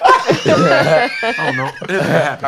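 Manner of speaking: words pushed out loud through laughter; real laughing talk; laughing while talking. Real, not acted. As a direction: laugh it out, barely holding words.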